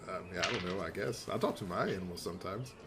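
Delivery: low, soft, offhand reply; absent-minded mumble. Acted. Man busy with his book answers absently, low and soft. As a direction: answer quietly, only half attending.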